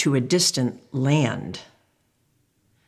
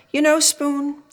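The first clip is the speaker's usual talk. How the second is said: mom voice; 10 semitones higher than usual. Acted. Mom addressing Spoon.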